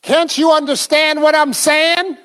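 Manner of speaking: high-pitched, impatient demand; exasperated husband. Retold or imitated. Husband arguing with his wife, exasperated. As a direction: imitate a spouse losing patience. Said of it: "none of y'all talk like that".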